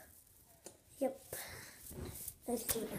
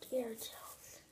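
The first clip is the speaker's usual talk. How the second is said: whispering; 4 semitones lower, 8 dB quieter than usual. Real, not acted. Whispering to the dog.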